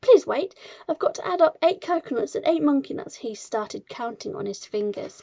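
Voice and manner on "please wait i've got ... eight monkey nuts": high, louder schoolboy plea; flustered pleading. A narrator's reading. Wilfred begs time to count, flustered cry. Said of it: "Poor Wilfred was properly flustered".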